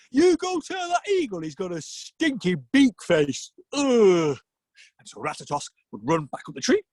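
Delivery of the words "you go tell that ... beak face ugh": high, indignant character voice; offended snake. Acted. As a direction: hurl it back as a disgusted insult. Snake insults the eagle back.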